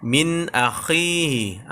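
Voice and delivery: slow min akhihi with a crisp n, no hum; clear n. Shown as a demonstration. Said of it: "kita lihat tulisannya".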